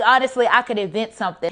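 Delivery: normal talking voice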